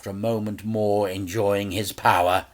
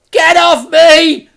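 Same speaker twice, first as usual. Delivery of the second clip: much higher, loud slow scream; scream, struggling to get free; boy's scream; 16 dB louder, slower than usual. A narrator's reading. Frank screams at the henchman grabbing him. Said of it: "screamed the boy".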